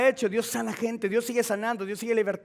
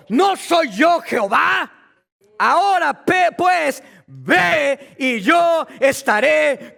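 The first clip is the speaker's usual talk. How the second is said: God's rebuke, higher and louder, slow; preacher voicing God's challenge, slow and much louder; fiery preaching; 6 semitones higher, 7 dB louder, slower than usual. Preaching God's words at full force.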